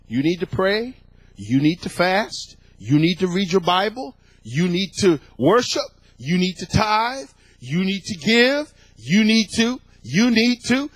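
each demand ends on a rise; insistent listing. Retold or imitated. Preacher mimicking what he was taught as a believer, insistent listing. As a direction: build a repetitive list, climbing in pitch and force. Recites list of old religious demands.

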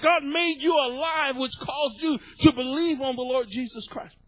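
high burst of praise, then tumbling on; heart-exploding excitement. Retold or imitated. Preacher reliving the moment his heart exploded, excited praise. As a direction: exclaim it to God, all worked up. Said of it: "And my heart exploded, and I was like".

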